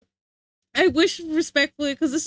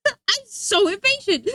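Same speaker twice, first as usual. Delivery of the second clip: laughing admission; 7 semitones higher, 5 dB louder than usual. Real, not acted. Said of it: "because I am so impatient".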